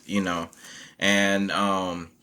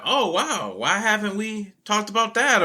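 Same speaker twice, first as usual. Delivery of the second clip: high-pitched imitated wonder; amazed realization; 12 semitones higher than usual. Voicing the kids seeing female superheroes, high-pitched amazement. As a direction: act out wide-eyed discovery, pitched way up.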